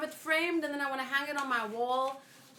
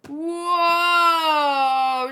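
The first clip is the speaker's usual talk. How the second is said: long, louder, higher shocked whoa; 5 semitones higher, 8 dB louder than usual. Acted. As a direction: gasp out a big whoa. Character reacts in manga.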